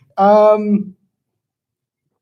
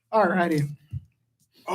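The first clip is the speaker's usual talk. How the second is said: ordinary talk, nothing marked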